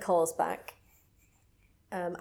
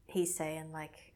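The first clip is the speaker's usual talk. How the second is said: usual speaking voice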